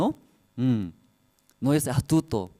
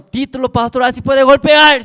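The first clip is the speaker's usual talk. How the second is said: very loud, high outraged challenge; mock fury at a pastor; indignant parent; 11 semitones higher, 14 dB louder than usual.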